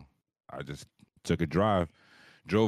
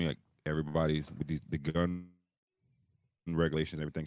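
usual speaking voice

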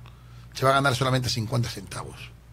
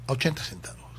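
hushed, breathy aside; secretive whisper; 9 dB quieter than usual. Real, not acted. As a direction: whisper it like a scandal. Confiding the price.

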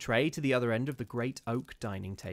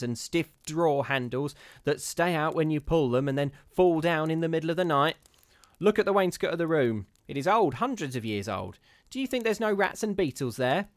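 put-on higher, louder scornful old charwoman; the charwoman scoffing at ghosts, higher and louder; superior charwoman; 4 semitones higher, 6 dB louder than usual. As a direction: sniff down at the gentleman's fears. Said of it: "sniffed in a superior manner".

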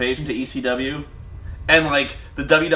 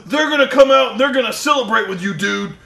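higher-pitched wrestler promo, building in force; Hulk Hogan; 5 semitones higher than usual. Retold or imitated. Host mimics a wrestler calling guys out to celebrate, hyped promo impression. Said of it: "He's, he's like".

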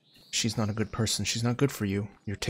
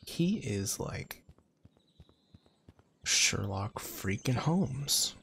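slowed right down, dry and sarcastic; slow sarcastic drawl; much slower than usual. Real, not acted. Gamer mocking the clever boy in the game, slowly. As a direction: drag it out mockingly.

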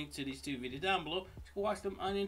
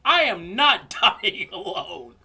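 loud, slow, high shout; 4 semitones higher, 13 dB louder than usual. Acted. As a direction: yell it out in mock panic. Refuses to die alone, shouting.

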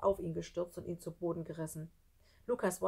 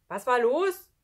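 loud, higher questioning shout; raised, high woman's voice; woman calling out; 6 semitones higher, 11 dB louder, slower than usual. Acted. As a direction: call down the hallway. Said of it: "hörten sie eine Frauenstimme".

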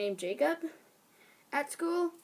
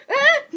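mock shriek; 14 dB louder than usual. Bus scene climax.

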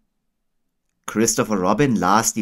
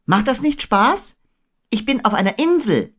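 put-on high child character voice; Christopher Robin; 3 dB louder than usual. A narrator's reading. Boy telling Owl about his island, high-voiced. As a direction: pitch it well up, as a boy. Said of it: "sagte Christopher Robin".

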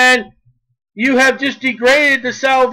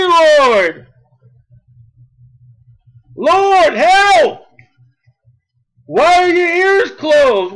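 high, shouted plea of someone in trouble; acted cry for help; 6 semitones higher, 4 dB louder, slower than usual. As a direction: cry out desperately to the Lord, stretching the calls. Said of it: "Here's a cry that someone's in trouble, like".

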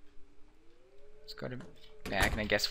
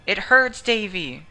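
loud, high anguished plea; mother wailing her pain; 9 semitones higher, 10 dB louder than usual. Acted. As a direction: cry out the hurt.

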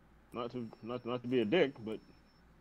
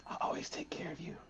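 soft tender whisper; 6 semitones higher, 5 dB quieter than usual. Acted. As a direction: breathe it to the scythe. Kissing the scythe tenderly.

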